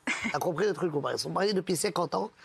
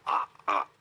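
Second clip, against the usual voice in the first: stammer, then stall on dans le; stuttering Charlie; 10 semitones higher than usual. Acted. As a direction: Charlie stammering out that he works inside the phone. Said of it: "un mec qui bégaye".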